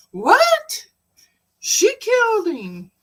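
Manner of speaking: high, loud incredulous what; hearing the news on the phone, high and loud with shock; shocked disbelief. Retold or imitated. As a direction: squeal it in stunned disbelief. Retold shocked reply on phone.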